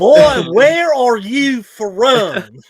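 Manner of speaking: very high, loud, drawn-out mock astonishment; baffled Tennessee local. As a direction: stretch it out like a hammed-up Southern local. Acting baffled Tennessee locals meeting Jesse.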